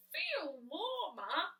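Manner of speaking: muffled and slow, from under a sheet. Acted. Barnaby answering muffled from under the sheet. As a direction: speak as if buried in cloth. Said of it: "came the muffled reply".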